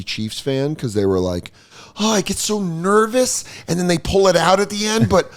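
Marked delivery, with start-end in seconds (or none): 2.0-5.3 whiny nervous fan. Retold or imitated. Podcaster mocking a nervous Chiefs fan, high whiny voice.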